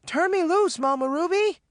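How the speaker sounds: very high, urgent command; frantic order. A narrator's reading. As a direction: demand to be let go. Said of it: "Maureen ordered".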